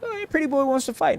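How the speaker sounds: high, sneering mock jeer; mocking taunt. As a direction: jeer like a bully picking a fight. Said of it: "they're like".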